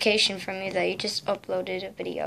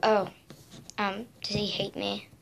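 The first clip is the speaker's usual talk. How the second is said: usual speaking voice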